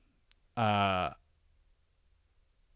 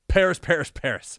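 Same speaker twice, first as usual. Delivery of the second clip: calling out; 6 semitones higher, 7 dB louder than usual. Retold or imitated. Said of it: "And I go".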